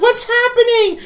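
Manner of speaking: very high, loud, squealed alarm; mock panic. Skit girl reacts to the exorcism in mock panic. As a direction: shriek it like a hammy skit actress.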